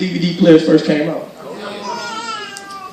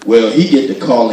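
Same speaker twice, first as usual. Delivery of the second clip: normal talking voice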